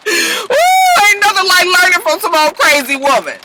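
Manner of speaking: high, loud teasing wail; gleeful hooting. Real, not acted. Teasing Bishop about a crazy woman, gleeful hooting. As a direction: whoop it out high after a laugh.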